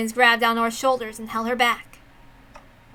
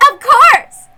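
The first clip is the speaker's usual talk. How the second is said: much higher, louder gleeful burst; eager exclamation; 10 semitones higher, 13 dB louder than usual. Acted. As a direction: throw it out with glee. Eager loud reply.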